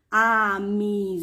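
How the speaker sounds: Amizade drawn out very slowly, a-mi-za-de beat by beat; split into syllables. Shown as a demonstration. Teacher showing pupils a four-syllable word, slowly syllabified. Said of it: "polissílabas".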